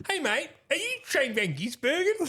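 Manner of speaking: a hint of tipsy, high-pitched greeting; mock drunk. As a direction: imitate a drunk mate calling out, high-pitched. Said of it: "drunk himself".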